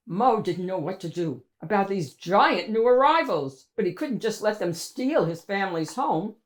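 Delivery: usual speaking voice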